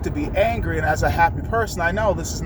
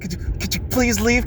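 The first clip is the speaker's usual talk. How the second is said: ordinary talk, nothing marked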